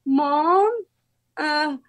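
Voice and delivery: high, drawn-out happy wail; emotional announcement. Retold or imitated. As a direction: reenact breaking big news to mom, stretching it out. Retold call to mom with news.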